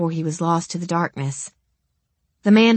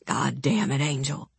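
hissed; 4 semitones lower, 6 dB quieter than usual. Acted. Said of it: "Philip hissed".

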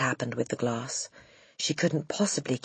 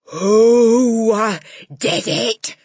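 furious roar, much higher and louder; 8 semitones higher, 14 dB louder, much slower than usual. Acted. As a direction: roar it at the whole class like a raging headmistress. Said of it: "she roared".

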